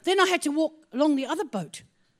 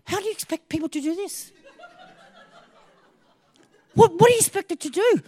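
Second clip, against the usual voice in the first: raised, incredulous complaint; exasperated quote; 7 semitones higher, 5 dB louder than usual. Retold or imitated. Retelling her complaint to him, raised and incredulous. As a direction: loudly ask him how anyone could do this. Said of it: "I said to him".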